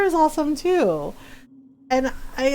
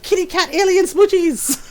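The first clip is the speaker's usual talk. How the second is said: high, cutesy mock excitement; imitated giddy, pitched-up delight; gleeful teasing; 6 semitones higher, 3 dB louder than usual. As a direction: squeal it like a teasing friend. Mimicking mod's gleeful teasing.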